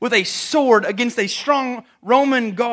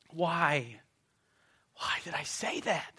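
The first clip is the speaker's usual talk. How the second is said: regretful whisper; 4 semitones lower, 10 dB quieter, slower than usual. Acted. Peter's regret, inner voice.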